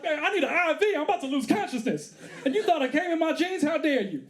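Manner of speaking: high-pitched, indignant comic protest; mock pissed off. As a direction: protest as the wronged party. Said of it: "I was pissed too".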